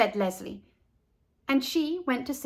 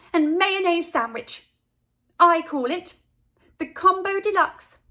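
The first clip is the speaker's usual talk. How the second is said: higher, louder, haughty list; proud correction; 7 semitones higher, 5 dB louder than usual. A narrator's reading. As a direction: set him straight. Carla proudly explains her sandwich.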